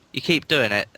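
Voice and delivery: normal talking voice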